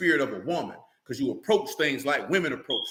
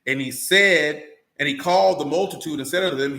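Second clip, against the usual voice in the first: usual speaking voice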